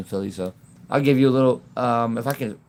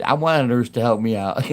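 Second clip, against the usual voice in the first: ordinary talk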